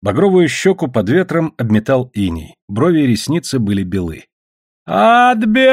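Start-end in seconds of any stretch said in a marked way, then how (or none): 4.8-5.7 high, loud, drawn-out crying wail; sudden wail. A narrator's reading. Drunk man crying over his furs, wailing. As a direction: burst out wailing as if robbed. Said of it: "вдруг заплакал Покпа".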